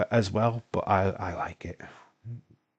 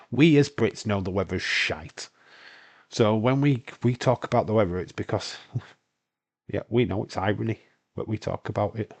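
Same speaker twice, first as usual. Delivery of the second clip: ordinary talk, nothing marked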